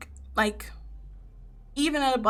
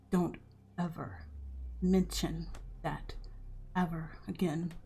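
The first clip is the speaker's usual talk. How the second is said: quiet menace; 2 semitones lower, 8 dB quieter than usual. Acted. Darius menacing the grandfather.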